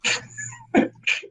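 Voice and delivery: squealing high laugh. Real, not acted. Laughing at own joke.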